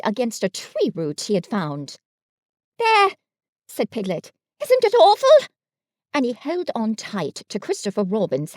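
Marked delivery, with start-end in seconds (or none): 2.8-5.5 very high and loud; scared squeal. A narrator's reading. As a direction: shrink back in terror. Piglet frightened by noise.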